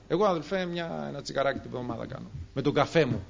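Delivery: soft, low, offhand excuse; imitated smoker. Smoker making light of one cigarette, soft offhand mock. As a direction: shrug it off like a casual smoker. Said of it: "μερικοί άνθρωποι νομίζουν".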